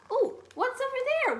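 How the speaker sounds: small mouse calling to her friend; little mouse. Acted. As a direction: call out as a tiny eager mouse. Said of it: "wondered Mouse".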